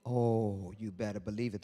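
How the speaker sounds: ordinary delivery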